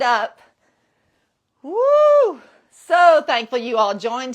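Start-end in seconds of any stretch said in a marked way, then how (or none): 1.6-2.4 high, loud cheer; excited whoop. Real, not acted. Instructor pumping up the warm-up class, high whoop.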